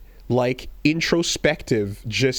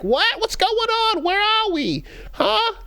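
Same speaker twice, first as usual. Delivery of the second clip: much higher, squeaky bewildered voice; dazed host. Retold or imitated. Host waking in her own body, much higher and bewildered. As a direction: squeak it out, lost and confused. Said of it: "the host takes back over their own brain and is like".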